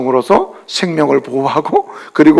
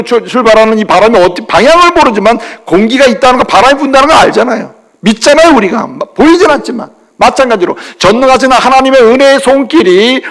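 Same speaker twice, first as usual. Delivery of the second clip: raised, higher preaching voice building to hallelujah; fervent preaching; 5 semitones higher than usual. Acted. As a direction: preach it out with full conviction. Preaching at full force.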